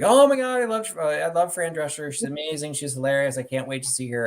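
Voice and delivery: high, excited fangirl imitation; gushing fan. Exchange student gushes about her favourite actress, mock-excited imitation.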